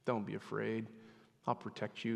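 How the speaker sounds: hushed and soothing, as at bedtime; tender reassurance. Retold or imitated. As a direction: quietly comfort a scared child. Father's promise recalled.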